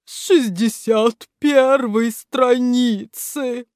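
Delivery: a statue sniffling out an answer; sobbing. Acted. The statue answers Грамотейка, sniffling. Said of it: "всхлипнула статуя".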